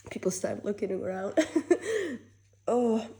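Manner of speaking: ordinary talk, nothing marked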